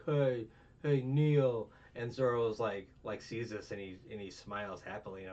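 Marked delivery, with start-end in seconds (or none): 0.0-1.7 loud, raised-pitch mock command; acted bossy shout; imperious order. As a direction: call out an order as a haughty villain. Voicing Celestial ordering Zoro to kneel.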